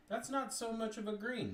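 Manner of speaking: high-pitched, loud surprise; surprised. Real, not acted. Painter reacting to moss green turning gold, surprised.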